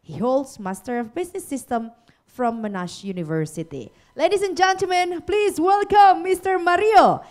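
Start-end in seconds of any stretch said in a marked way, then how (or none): none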